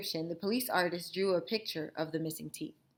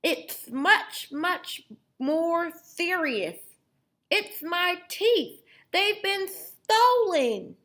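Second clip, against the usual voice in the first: high, loud, slow gummy voice; toothless grandpa; 11 semitones higher, 8 dB louder, much slower than usual. Acted. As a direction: mumble grandly like a man with no teeth. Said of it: "make her voice sound like somebody who doesn't have any teeth".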